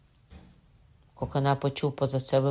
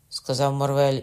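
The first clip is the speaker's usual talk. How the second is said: usual speaking voice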